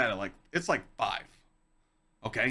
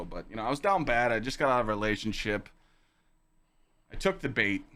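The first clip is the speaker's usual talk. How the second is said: normal talking voice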